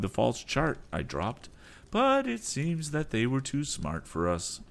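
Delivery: high, calm captain's voice; captain advising. Acted. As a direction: plan the escape steadily. Captain voice held.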